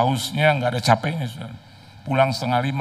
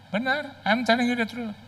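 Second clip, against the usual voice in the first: put-on much higher, pleading young woman; high girlish plea; 13 semitones higher than usual. Daughter insisting to her father, high pleading voice. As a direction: swear it in a high girl's voice.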